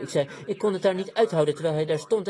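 usual speaking voice

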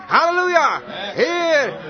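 loud, high-pitched jubilant cry; exultant shout. Retold or imitated. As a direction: imitate a son shouting praise. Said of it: "schreeuwde hij het gewoon uit". (juichend uitgeroepen)

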